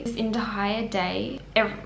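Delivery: usual speaking voice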